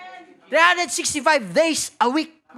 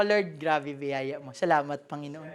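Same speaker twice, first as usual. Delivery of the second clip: hushed prayer; 13 semitones lower, 9 dB quieter than usual. Retold or imitated. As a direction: pray it quietly, almost to yourself. Recalling his quiet prayer of thanks.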